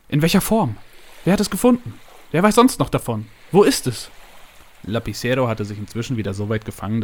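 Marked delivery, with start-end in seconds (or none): 0.1-4.1 high, loud volley of questions; tumbling questions. A narrator's reading. Boss grills secretary about the gold find, eagerly questioning. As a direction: fire each question eagerly, pitch raised. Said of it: "überschlug sich mit Fragen".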